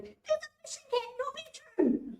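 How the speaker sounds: put-on small child spotting the cat on Zoom, delighted cry; excited little kid. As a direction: squeal it like a thrilled toddler. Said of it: "you can hear some little kid going".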